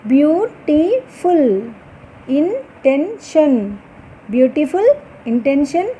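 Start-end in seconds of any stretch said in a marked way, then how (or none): 0.1-1.4 very slow, each syllable pulled apart, falling at the end. Shown as a demonstration. Teacher sounding out syllables slowly for the class. 2.3-3.8 very slow, each syllable separated, falling at the end. Shown as a demonstration. Teacher sounding out syllables slowly for the class.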